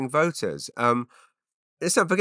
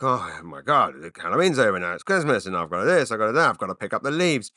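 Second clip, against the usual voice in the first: whiny moaning about chores, half-mocking; exaggerated seasonal moaning; mock whining complaint. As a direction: grumble like everyone does about the season. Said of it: "we're all kind of complaining about".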